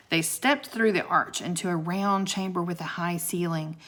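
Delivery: ordinary delivery, nothing marked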